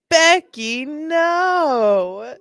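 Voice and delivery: high, drawn-out playful lament; mock dismay. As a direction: wail the name in hammed-up disappointment. Mock dismay at suspect's name.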